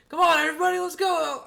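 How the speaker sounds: very high, loud rally call; hyping crowd. Retold or imitated. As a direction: pump up the guests.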